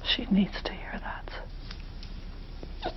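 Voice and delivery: slow hushed whisper; a slow whispered aside to the room. Real, not acted. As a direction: breathe it as a quiet aside. Therapist aside during exercise.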